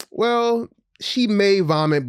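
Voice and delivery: high, drawn-out hedging answer; hedging dental worker. Retold or imitated. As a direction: imitate a careful clinician, pitched well up.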